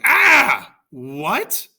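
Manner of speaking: very high, loud shocked yells of pain. A narrator's reading. Achilles struck in the heel, yelling in high shocked pain. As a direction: cry out as the arrow strikes. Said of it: "Achilles yells".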